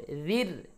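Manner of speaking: জির ending in a light, thin r. Shown as a demonstration. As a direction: say জির with the r not thickened, tongue forward. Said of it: "এখানে র আবার পাতলা হবে". (জির-এর র পাতলা করে)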